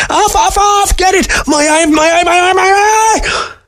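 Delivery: very high panicked weasel shriek; frantic screech. A narrator's reading. Weasel panicking over his lost eye, shrill frantic screech. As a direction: screech rapid repeats, stretching the last one.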